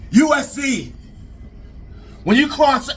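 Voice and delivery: high, hyped-up proclamation; big high-pitched promo voice; wrestling promo. Acted. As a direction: announce it like a wrestler's threat. Launches into wrestling catchphrase promo.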